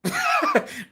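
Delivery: short high-pitched laugh; sudden high burst of laughter; helpless laugh. Real, not acted. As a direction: let a brief laugh escape. Laughs at serious squatter story.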